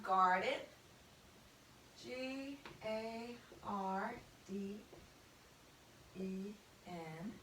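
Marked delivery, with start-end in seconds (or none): 2.0-7.3 slow spelling. Shown as a demonstration. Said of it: "spelling out letters".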